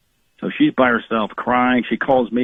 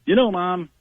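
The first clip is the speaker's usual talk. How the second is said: put-on a higher, whiny kid's plea; imitating teenage son; 8 semitones higher than usual. Son telling mom he can't do homework, lightly imitated. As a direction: lightly put on the son's excuse voice.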